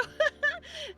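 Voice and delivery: short, very high laugh; tearful laugh. Real, not acted. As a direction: let out a high, teary giggle. Tearful laugh while greeting.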